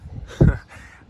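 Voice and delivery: burst of laughter. Real, not acted. Laugh after helper's remark.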